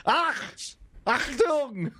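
put-on high, comic German accent; hammed-up German exclamation. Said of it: "give him a German accent".

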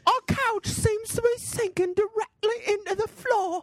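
silly squeaky mock British; hammed-up squeaky homeowner; high squeaky voice. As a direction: answer in a put-on high squeak. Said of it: "high squeaky voice convention".

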